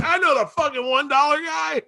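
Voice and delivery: very high mock-excited yell; excited crowd member. Everyone recognizing the one dollar guy, high excited mock yell. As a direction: squeal it as a thrilled audience member.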